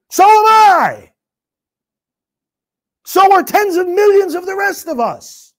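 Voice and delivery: high, loud proclaiming burst; booming, high-pitched defiance; loud declaration. Real, not acted. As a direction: shout it out as a rallying cry. Declaring his own anger.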